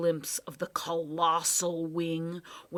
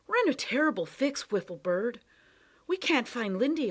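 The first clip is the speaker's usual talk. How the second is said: higher, urgent and worried; anxious boy; 6 semitones higher, 6 dB louder than usual. Acted. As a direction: plead with rising worry. Tom pleading to bird.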